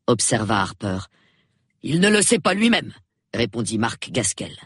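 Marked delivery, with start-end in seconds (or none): 1.8-2.9 a lightly voiced, restless tall man, a little higher; agitated man. A narrator's reading. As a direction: hurry to brief the police. Gaskell replies to Harper. (un homme agité, voix un peu plus haute)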